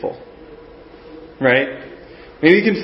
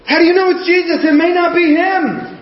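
high, anxious disciple voice, far higher than his own; worried disciples; 11 semitones higher than usual. Retold or imitated. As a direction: voice the doubting disciples pleading with Peter. Disciples doubting Peter, voiced.

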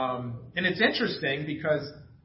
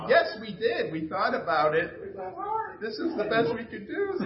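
high, sheepish protest; hammed-up excuse; mock defensive; 8 semitones higher than usual. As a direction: plead your case, laughing at yourself. Family defending their gifts.

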